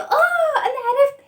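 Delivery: high, excited cry of discovery; as if the idea just hit; a bright gasp of I got it; sudden eureka. Acted. Fatha suddenly finds the answer.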